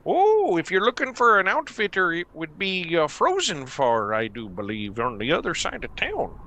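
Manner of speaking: eager helpful Minnesota local; Minnesota accent. Acted. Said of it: "Minnesota accent".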